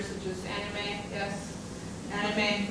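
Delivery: ordinary talk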